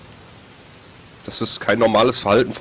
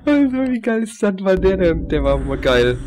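excited; 8 semitones higher than usual. Real, not acted. Thrilled after surviving the scare.

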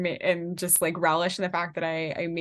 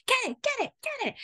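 squealing high panicked urging; frantic urging; faster than usual. Retold or imitated. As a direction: egg someone on mid-chase, shrieking. Auditioner chasing mouse, retold.

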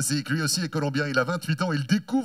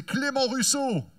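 the name pitched higher and slowed; announcer name call; 8 semitones higher, slower than usual. Real, not acted. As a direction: lift the name for the fans. Announcer presents rider to crowd.

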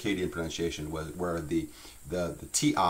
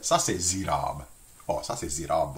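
Louisiana French; 2 semitones lower than usual. Shown as a demonstration. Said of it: "Try that".

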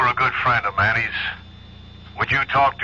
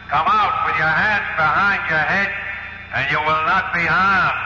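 through a bullhorn; 6 semitones higher than usual. Acted. Police chief calling to the gang through a bullhorn. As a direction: announce it through a megaphone to the building. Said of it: "I'm gonna talk to 'em on a bullhorn".

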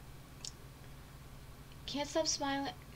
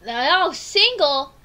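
slow and much louder, in disbelief; loud, stunned "single?"; 3 semitones higher, 14 dB louder, much slower than usual. Real, not acted. Player shocked that the date is single, loud disbelief. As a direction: drag it out in shock.

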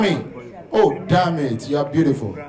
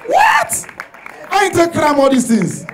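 high-pitched, shouted disbelief; incredulous outburst; 4 dB louder than usual. Acted. Comedian protesting to the crowd, incredulous shout. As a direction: yell it in shrieking protest.

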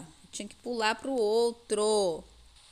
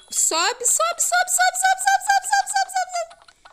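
high frantic squeal; panicked shrieking; 9 dB louder, slower than usual. Real, not acted. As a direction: shriek it over and over. Urging climb while chased.

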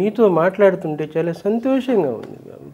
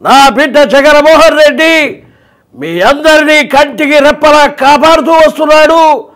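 high, slow, loud motherly blessing; elderly mother's voice; 9 semitones higher, 7 dB louder than usual. Shown as a demonstration. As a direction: bless the son like a devoted mother. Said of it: "ఇట్లా ఉంటుంది".

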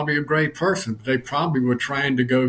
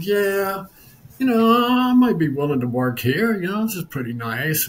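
put-on cocky young applicant; 7 semitones higher than usual. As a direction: play the entitled kid sizing up the room. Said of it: "he said".

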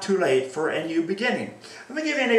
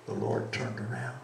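hushed sorrow; 5 semitones lower, 6 dB quieter than usual. Acted. Preacher telling of Peter weeping, hushed and grave. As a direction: let it sink in quietly.

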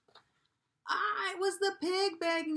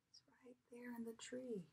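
whisper; 19 dB quieter, faster than usual. Acted. Secret hint to listening child.

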